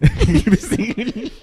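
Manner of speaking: high bright laugh; burst of laughter. Real, not acted. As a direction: break out laughing.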